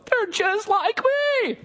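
high slow astonished line; dawning amazement, drawn out; mock realization. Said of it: "We'd probably stop and go".

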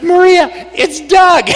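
high, drawn-out retold announcement; gleeful exclamation. Telling his wife who the caller is, gleeful exclamation. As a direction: stretch it out high, sure you've caught the prankster.

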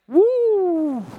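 high, loud drawn-out "woo"; excited whoop. Real, not acted. Preacher to congregation after reading scripture, whooping. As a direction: let out one long, high whoop. Preacher exclaims over the verse.

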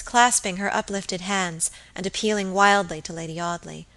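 plain reading voice

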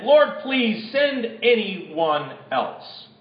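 drawn-out, very slow plea; slow pleading. Acted. Moses begging the Lord to send another, slow and pleading. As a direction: stretch each word, begging. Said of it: "pleaded again".

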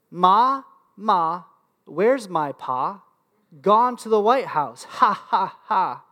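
slow, spaced-out mocking chant; slow slogan recital. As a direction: draw out each phrase, with pauses. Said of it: "I'm gonna say this slowly".

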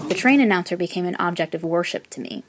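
ordinary delivery

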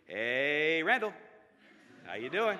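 lightly imitated drawn-out hello; friendly greeting. Friend greeting narrator with a thumbs up, drawn-out greeting. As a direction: stretch the greeting, rising on the name.

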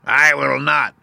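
high, loud witch voice; witch's sharp retort. A narrator's reading. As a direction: refuse defiantly as the witch. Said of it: "retorted the witch".

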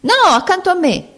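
high, loud competing shout. Acted. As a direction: outshout the other woman. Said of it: "urlava un'altra". (gridato acutissimo)

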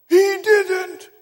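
put-on high, loud outraged little boy; shouted out. Little boy correcting the preacher, outraged shout. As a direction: repeat the protest as a boy's shout. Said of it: "shouted out".

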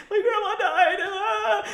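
high-pitched mock crying wail. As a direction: wail it out like a hammed-up sob. Retold tearful arrival at contest.

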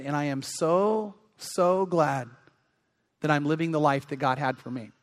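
hushed, earnest testimony; retold heartfelt gratitude. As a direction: quote it tenderly, letting each phrase settle. Quotes the old pastor's heartfelt testimony.

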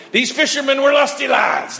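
loud, high proclamation of "lusty lads"; booming retold line. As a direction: belt it out like a hearty declaration. Retold line to wife, shouted.

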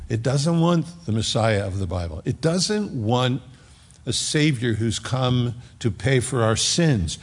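usual speaking voice

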